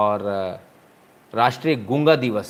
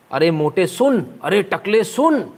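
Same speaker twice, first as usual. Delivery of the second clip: slow, higher jeering taunt; hammed-up street taunting; mock taunt; 6 semitones higher, 3 dB louder, slower than usual. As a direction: imitate a crowd heckling someone. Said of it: "ऐसी-ऐसी भाषा का इस्तेमाल हो रहा था".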